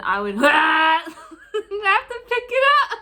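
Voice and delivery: slow, loud laughing through words; bursting out laughing. Real, not acted. Laughing at the memory.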